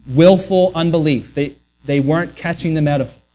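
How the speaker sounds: ordinary delivery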